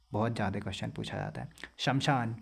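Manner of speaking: normal talking voice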